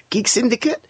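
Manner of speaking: imitated American accent with lifted, questioning pitch. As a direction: put on an American accent for her. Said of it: "That was my American accent".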